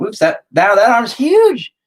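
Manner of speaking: high, loud yelp of dismay; startled exclamation. Real, not acted. Painter spotting an oversized arm, startled and loud. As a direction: blurt it out, pitch leaping up.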